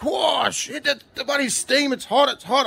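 high, alarmed retold yelp at the steam; startled yelp. Dad retelling the pasta steam to co-hosts, startled high yelp. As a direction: replay the moment the heat hit you.

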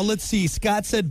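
ordinary talk, nothing marked

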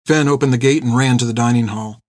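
ordinary delivery

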